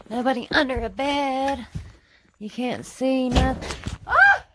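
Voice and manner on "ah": sudden high shriek of fright; real frightened scream; startled scream. Real, not acted. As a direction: scream as the door slams. Door slams, she screams.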